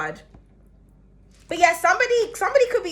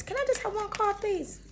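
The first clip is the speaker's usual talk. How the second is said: whiny plea; 8 semitones higher, 8 dB quieter than usual. Real, not acted. Pleading with the cards.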